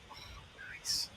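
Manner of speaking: breathy whispered nice; soft whispered approval. Real, not acted. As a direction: whisper it to yourself. Quiet reaction to chat.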